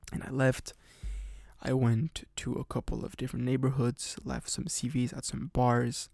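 ordinary talk

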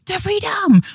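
high, loud cry of the freedom; hammed-up shout; mock dramatic cry. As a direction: belt it out like a rallying cry. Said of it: "I keep saying".

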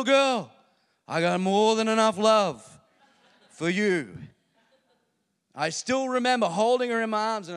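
loud, fervent pulpit delivery; rousing sermon voice; full-force preaching. Acted. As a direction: preach it out to the whole room.

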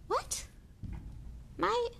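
put-on very high, halting boy's question; stunned boy. A narrator's reading. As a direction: squeak it out in astonishment. Harry stunned by news.

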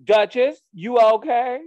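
high, loud retold call; mock calling from the background; imitating calling out. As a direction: call over sharply, high-pitched, as the concerned sister.

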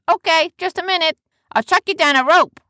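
loud, high character voice; busy deckhand calling out. Acted. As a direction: holler down from the ship.

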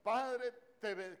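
loud, high, drawn-out prayer call; praying pastor. Retold or imitated. Pastor opening a public prayer on video, loud imploring call. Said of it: "dice el pastor".

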